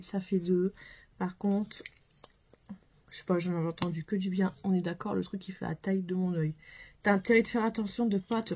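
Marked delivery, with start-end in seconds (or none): none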